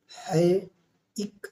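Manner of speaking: ordinary talk, nothing marked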